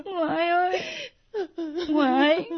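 crying, contrite apology; tearful pleading. Acted. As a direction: beg forgiveness through tears. Grandchild tearfully begs grandmother's forgiveness.

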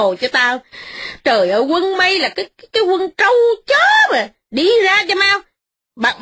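put-on old lady shrieking at the traitor; furious scolding. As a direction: scream him out of the house. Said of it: "hét vang rân mà mắng".

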